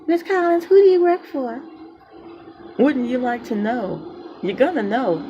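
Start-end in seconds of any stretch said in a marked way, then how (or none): 0.0-1.6 high, mocking imitation of a caller; mock question voice. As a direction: parrot the official mockingly.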